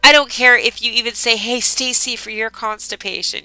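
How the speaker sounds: loud, upbeat host sign-off; a streamer hypes her next upload while signing off; energetic outro. Acted. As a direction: pump up the viewers before saying goodbye.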